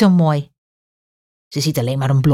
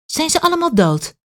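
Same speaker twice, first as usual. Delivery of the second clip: put-on high, wide-eyed child's question; little girl amazed, pitched way up; 12 semitones higher than usual. A narrator's reading. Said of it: "vraagt Kaat vol verbazing".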